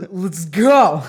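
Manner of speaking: loud, high cry through laughter; playfully rally the troops; hyped-up drunk rally. Retold or imitated. Friends hanging over each other set off, hyped up.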